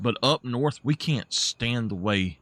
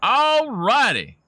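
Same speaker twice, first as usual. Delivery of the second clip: playful drawn-out; 12 semitones higher, 6 dB louder, slower than usual. Real, not acted. Cheerful transition.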